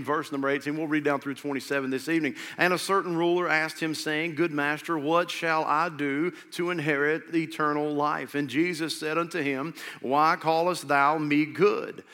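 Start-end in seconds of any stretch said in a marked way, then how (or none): 2.6-12.0 slow, solemn Bible reading; scripture reading. Acted. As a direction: read it out, weighty and deliberate. Reads scripture aloud.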